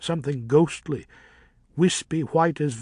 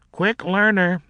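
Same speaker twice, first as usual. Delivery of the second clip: sarcastic mutter; 7 semitones higher, 7 dB louder than usual. A narrator's reading. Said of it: "muttered Yorick".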